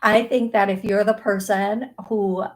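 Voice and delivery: ordinary talk, nothing marked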